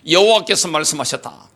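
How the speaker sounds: usual speaking voice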